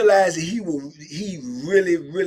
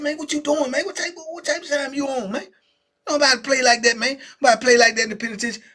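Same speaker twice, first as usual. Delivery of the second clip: higher, louder startled protest; outraged protest; 8 semitones higher, 4 dB louder, faster than usual. Retold or imitated. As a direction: push him off, rattled and offended.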